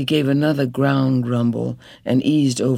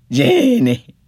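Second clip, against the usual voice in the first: much higher and louder, desperate; crying out her name; 11 semitones higher, 7 dB louder than usual. Acted. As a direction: call to her in sudden terror. Dying husband crying out.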